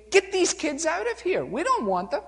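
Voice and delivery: put-on high, sharp shooing off the children; gruff disciples shooing kids. Preacher voicing disciples shooing children away. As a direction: wave the kids off impatiently.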